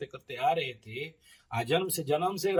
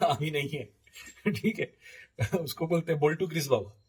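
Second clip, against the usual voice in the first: amused laughing. Real, not acted. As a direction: chuckle while introducing an absurd character. Amused while recalling a baba. (हँसते हुए बोलना)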